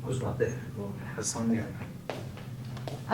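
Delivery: whispered, very slow and soft; confessing confusion to a friend in a whisper; hushed confession. Acted. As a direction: barely breathe it out, lost. Troubled confession in skit.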